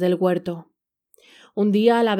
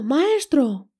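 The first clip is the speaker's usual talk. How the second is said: screaming; 6 semitones higher than usual. A narrator's reading. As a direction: call out again, less forceful. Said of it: "El arpa comenzó a gritar".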